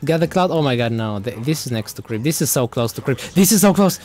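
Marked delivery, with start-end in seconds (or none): 3.3-4.0 relieved burst, higher and louder; excited. Real, not acted. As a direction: exclaim it with relief. Relief after near death.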